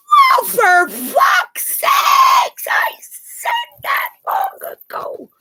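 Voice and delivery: very high, slow exasperated yell; exasperated shout. Real, not acted. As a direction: let it burst out in disbelief. Exasperated outburst on stream.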